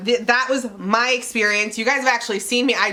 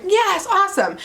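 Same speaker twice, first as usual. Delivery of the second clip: excited; 10 semitones higher than usual. Retold or imitated. Past self happy about coupon.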